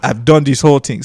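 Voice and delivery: put-on Nigerian English; Nigerian accent. Shown as a demonstration. Comedian recounting his whole act, Nigerian accent. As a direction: stay in the Nigerian character voice. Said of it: "I would talk like this".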